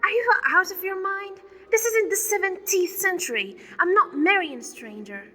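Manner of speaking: high, loud outraged protest; outraged shouting. Acted. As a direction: shout it in disbelief. Daughter refusing arranged marriage.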